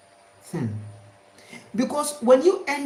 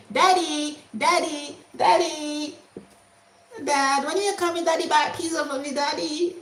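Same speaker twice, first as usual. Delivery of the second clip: put-on higher pleading kid calling daddy; child's voice; 7 semitones higher than usual. Child nagging father for pizza, in a higher pleading voice. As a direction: whine like a child tugging at dad.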